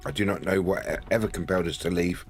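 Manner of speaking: normal talking voice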